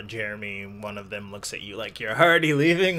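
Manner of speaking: ordinary delivery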